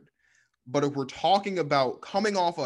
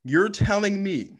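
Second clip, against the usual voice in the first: loud sharp voice; 6 semitones higher, 4 dB louder than usual. Real, not acted. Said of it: "I'm not yelling".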